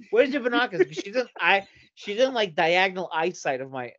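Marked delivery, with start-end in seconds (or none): 0.1-0.9 higher and louder rising taunt; mock question pushed up; teasing, higher repeat. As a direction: press the tease harder.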